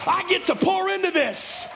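high ringing preacher's cry; shouted sermon line; preaching shout. Real, not acted. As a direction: lift it like a shout from the pulpit. Preacher at full force.

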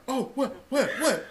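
high startled stammer. Retold or imitated. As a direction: caught off guard, jumping up. Coworker startled jumping up.

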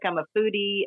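ordinary talk

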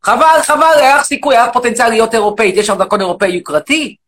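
shouting, indignant rant at the audience; loud mock rant. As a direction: bellow it like an outraged demagogue. Loud persona mocks caller.

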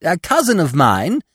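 high, loud, eager chatterer; excited high-pitched squirrel voice. A narrator's reading. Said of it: "he began eagerly".

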